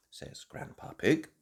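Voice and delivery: ordinary delivery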